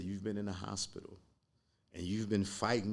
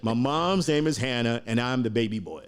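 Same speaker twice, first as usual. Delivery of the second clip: louder and higher, proudly explaining; excited; 4 semitones higher, 8 dB louder than usual. Real, not acted. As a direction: announce it with pride. Proudly explaining his name.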